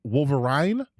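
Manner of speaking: "Wolverine" said WOHL-ver-ine, ending like "mine"; alternative pronunciation. Shown as a demonstration. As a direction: end "Wolverine" on an EYE-n sound, rising. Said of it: "I would give him Wolverine".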